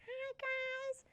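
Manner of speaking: very high, very soft little greeting; be the tiny larynx saying hi. Acted. The larynx greeting the class in a tiny high voice.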